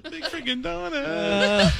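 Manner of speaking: high crying pop-star voice; [name] impression. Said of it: "And now enter [name]".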